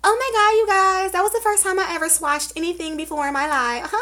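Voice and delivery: high, breathy mock innocence; mocking influencer voice. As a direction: play a fake-innocent influencer. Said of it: "she's gonna come out and be like".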